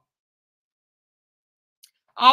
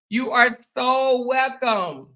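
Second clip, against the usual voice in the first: normal talking voice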